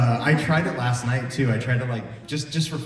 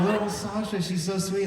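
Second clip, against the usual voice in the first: put-on higher, cooing over a sweet kid; higher fond coo; 10 semitones higher than usual. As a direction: coo it like she's adorable. Imitating her own fond reaction.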